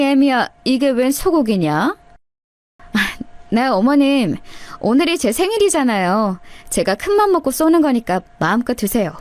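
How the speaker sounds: ordinary delivery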